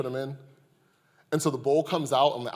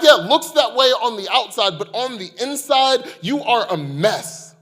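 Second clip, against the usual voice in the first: impassioned sermon rebuke; preaching at full force; 9 semitones higher, 9 dB louder than usual. Acted. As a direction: thunder the rebuke from the pulpit. Jesus voiced, building rebuke.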